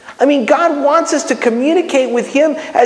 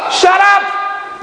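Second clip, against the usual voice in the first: high, abrupt shout; mock exasperated shout; 9 semitones higher than usual. Acts cutting off the rambling prayer.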